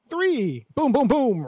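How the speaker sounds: boom sound effect. Acted. GM voicing rapid explosions.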